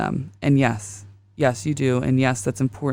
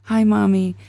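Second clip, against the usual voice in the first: higher, lightly voiced child's call; imitated little boy; 5 semitones higher, 4 dB louder than usual. Mom keeps hearing her son's greeting in her head, imitated child voice. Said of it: "I just kept hearing".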